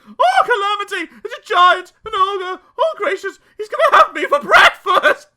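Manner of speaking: loud, high-pitched hysterics; panicked screaming. A narrator's reading. Mister Jelly mistaking the tramp for a giant, screaming. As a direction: scream as if about to be eaten. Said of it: "he screamed".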